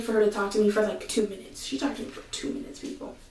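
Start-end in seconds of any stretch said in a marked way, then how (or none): 1.6-3.2 whisper. Real, not acted. Complaining aside to viewers.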